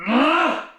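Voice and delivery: sharp high yell of exertion; effort yell. Real, not acted. Lifter crying out at the hardest rep, effort yell. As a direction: let out a loud straining cry. Peak of heavy lift.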